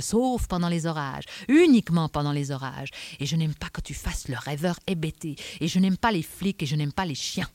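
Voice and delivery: loud, insistent tirade; angry Adamsberg. Acted. Inspector lists all he dislikes to Vasco, angry. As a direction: hammer out each grievance in a rising list.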